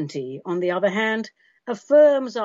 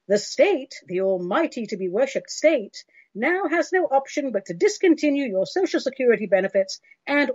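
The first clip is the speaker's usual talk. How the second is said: dystopian announcer. Pretend. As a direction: read it like a cold state robocall. Mock state robocall message.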